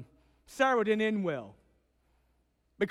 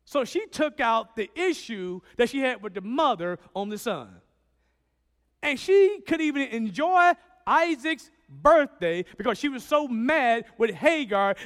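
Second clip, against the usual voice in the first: ordinary talk, nothing marked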